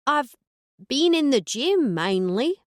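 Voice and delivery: put-on Australian accent creeping in; Aussie accent phasing in. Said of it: "phasing in the Aussie accent".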